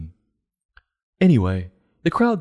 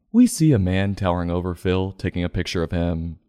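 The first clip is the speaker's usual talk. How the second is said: usual speaking voice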